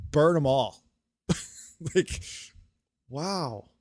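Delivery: ordinary delivery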